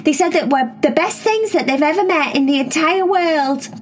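put-on excited kid asking her sisters questions; Cherry voice.

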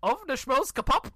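high loud gibberish; fake foreign language. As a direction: blurt out made-up language. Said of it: "I did, like".

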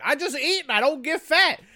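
high, loud mocking brag; exaggerated, high-pitched taunt; mocking imitation. Mocking envied people who stay thin.

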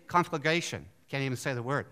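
usual speaking voice

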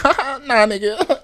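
loud, high mock laugh; laughing in their face. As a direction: imitate a girl laughing off a pickup line. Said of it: "laugh in their face".

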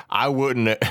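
usual speaking voice

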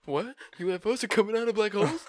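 high, fast incredulous cry; mock amazement. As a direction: play up the disbelief.

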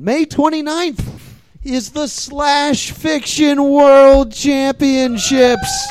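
very slow, drawn-out event billing; announcer hype. Real, not acted. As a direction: stretch each word like a ring announcer. Host bills upcoming show.

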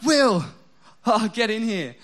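eager wife calling from the next room; excited call. Retold or imitated. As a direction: call him in to see something fun. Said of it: "Or it can be like".

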